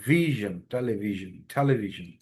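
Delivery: টেলিভিশন with v and zh, not ভীষণ; English vision. Shown as a demonstration. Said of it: "ভীষণ না বলে ভিশন". (ভ নয়, v আর zh দিয়ে ভিশন)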